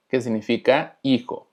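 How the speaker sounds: ordinary talk, nothing marked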